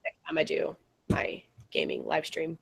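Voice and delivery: soft, voiceless whisper; a quick hushed aside. Real, not acted. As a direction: whisper it under your breath. Aside about next stream.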